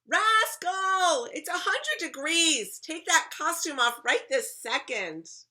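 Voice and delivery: high, very loud scolding shout; mom yelling. A narrator's reading. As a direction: yell at a misbehaving child. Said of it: "my mom yells".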